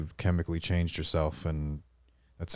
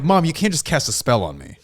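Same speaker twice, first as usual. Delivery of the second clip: retold protest to mom; 8 semitones higher, 4 dB louder than usual. Retold protest to his mother.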